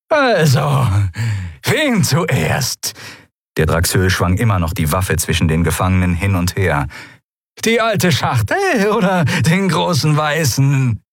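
gleeful mock choice; taunting creature thug. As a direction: toy with the prisoners. Brock taunting with his gun.

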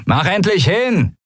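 a touch of irritated yelling; annoyed call. A narrator's reading. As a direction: snap at him to hurry. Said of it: "rief der Mensch mit den Agnenarben genervt".

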